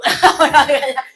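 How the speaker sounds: loud burst of laughter. Real, not acted. Speaker laughing after her joke, loud.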